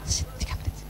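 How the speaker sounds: unvoiced whisper of a secret summons. Shown as a demonstration. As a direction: breathe it like a secret deal. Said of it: "Ngomong bisik-bisik". (bisikan rahasia)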